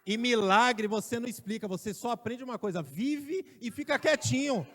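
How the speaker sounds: loud, high preaching voice; fiery preaching. Acted. Preacher exhorting the congregation, loud and high. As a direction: preach it out to the church.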